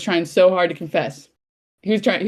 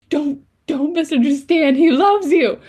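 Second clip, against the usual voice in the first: high, louder fangirl plea; frantic pleading; 9 semitones higher, 4 dB louder than usual. Retold or imitated. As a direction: plead with the character, pitched way up. Pleading with the character on screen.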